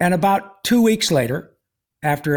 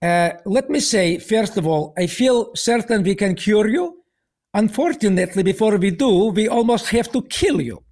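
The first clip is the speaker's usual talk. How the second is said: put-on higher-pitched, deliberate Russian-accented delivery; 5 semitones higher than usual. Russian oncologist greeting his new patient, in a Russian accent. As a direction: imitate a Russian doctor's accent. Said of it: "Russian accent".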